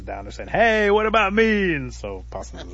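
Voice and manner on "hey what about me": high, loud, stretched-out call; acted plaintive shout from below. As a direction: holler up to a friend in the treetop. Turtle calls up the tree.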